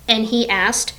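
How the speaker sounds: plain reading voice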